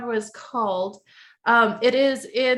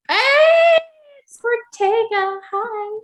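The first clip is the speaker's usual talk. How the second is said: high cutesy greeting; 13 semitones higher, 6 dB louder, much slower than usual. Real, not acted.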